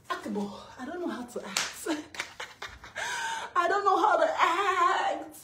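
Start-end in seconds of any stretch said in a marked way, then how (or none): 1.9-3.5 high, voiceless wheezing laugh; elated squealing laughter; gleeful laugh. Real, not acted. Overwhelmed with joy. 3.5-5.4 loud, high, drawn-out elated cry; euphoric outburst. Real, not acted. Bursting with joy.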